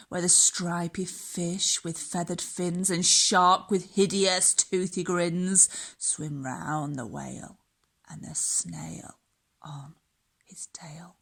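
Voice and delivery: plain reading voice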